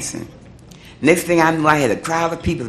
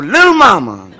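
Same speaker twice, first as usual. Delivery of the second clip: Little Mama called out louder with a laugh, Little stressed; delighted nickname; 6 semitones higher, 6 dB louder than usual. Retold or imitated. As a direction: hail the girl with a laughing grin. [name] names her, laughing.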